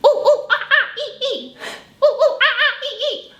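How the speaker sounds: high squealing monkey ooh ooh ah ah, laughing; monkey calls. Acted. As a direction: play a giggling little monkey. Monkey sounds in kids' song.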